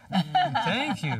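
a high, laughing thank-you. Real, not acted. Pastor laughs when his wife teases him about prayer.

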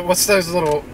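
normal talking voice

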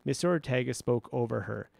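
plain reading voice

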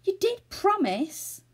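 high, slow, loud insistence; agitated, pleading complaint; anxious reproach. A narrator's reading. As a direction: drag out the reminder, worried and accusing. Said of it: "said Joe anxiously".

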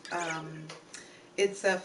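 ordinary talk, nothing marked

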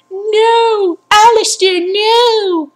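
high, loud hammed-up wail; mocking Lara. Gamer mocking Lara Croft crying over Alistair. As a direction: overplay a heroine's despair. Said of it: "And then she's like".

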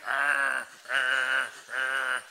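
loud, low rhythmic dog grunts; dog grunting. Acted. Dog humping, growling grunts.

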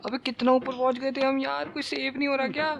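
high-pitched lament; disbelieving whine. Real, not acted. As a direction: moan about lost progress. Lost progress, disbelief. (ऊँची, रोनी-सी शिकायत)

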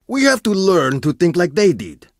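ordinary delivery

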